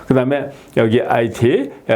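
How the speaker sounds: ordinary talk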